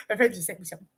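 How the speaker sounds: normal talking voice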